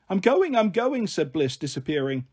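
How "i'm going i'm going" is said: put-on high-pitched, hurried child protest; protesting little girl. Little sister escaping Mum's tickle threat, protesting. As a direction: call it out like a child fleeing.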